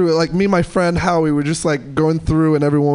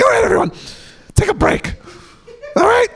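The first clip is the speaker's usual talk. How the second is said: screechy announcement. Retold or imitated. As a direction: boss the section around. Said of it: "she was just like".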